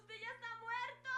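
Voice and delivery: terrified shriek. Acted. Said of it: "se escuchó un alarido".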